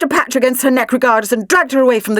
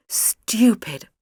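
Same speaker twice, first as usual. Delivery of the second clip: usual speaking voice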